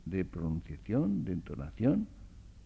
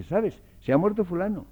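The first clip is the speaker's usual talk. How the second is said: higher, louder casual "Se ha muerto fulano"; natural casual telling; 9 semitones higher, 6 dB louder than usual. Shown as a demonstration. Lecturer acts the everyday way of telling breakfast news, lively. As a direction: tell your breakfast companion casually, pitched up.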